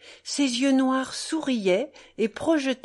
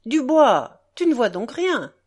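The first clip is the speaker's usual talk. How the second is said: much louder barked command; brutal order; 3 semitones higher, 7 dB louder than usual. A narrator's reading. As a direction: snap the order at the young worker. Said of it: "s'adressant brutalement".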